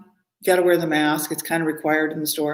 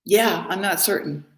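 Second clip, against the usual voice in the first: usual speaking voice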